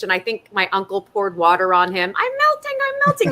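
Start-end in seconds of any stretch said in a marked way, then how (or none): 2.2-3.3 much higher mock witch wail; melting witch. Dad as the Wizard of Oz witch, high mock wail. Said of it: "And my dad was the witch".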